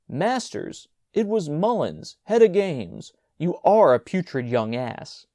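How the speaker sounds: high scornful scolding; exasperated scolding. A narrator's reading. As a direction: tell him off in scorn. Major scolding brother.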